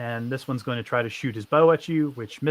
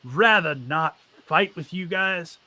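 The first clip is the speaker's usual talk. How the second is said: higher, firm, guarded NPC voice; a bandit boss bargaining with the party; bandit leader, wary; 4 semitones higher than usual. Acted. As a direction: warn them off without a fight.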